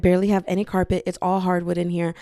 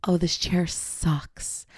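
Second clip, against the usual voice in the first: muttered; 3 semitones lower, 6 dB quieter, much slower than usual. Retold or imitated. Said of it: "And then I go".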